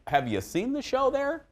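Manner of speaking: high-pitched mock disbelief; exaggerated, sky-high comic protest; incredulous retort. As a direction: squeal it back as a mock-incredulous comeback. Mock retort to viewer's snarky comment.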